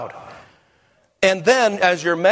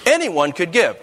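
high, slow, emphatic preaching with pauses; stretched, raised pulpit delivery; preacher's paraphrase; 5 semitones higher, slower than usual. Shown as a demonstration. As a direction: proclaim it, lifting "God" and pausing after it. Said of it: "That's a paraphrase".

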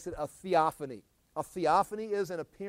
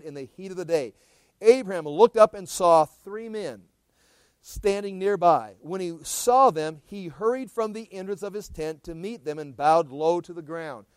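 louder, measured Bible reading voice; scripture reading; 10 dB louder than usual. Acted. Teacher reading Genesis aloud to his class, measured and loud.

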